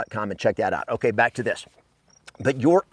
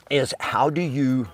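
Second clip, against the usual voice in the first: usual speaking voice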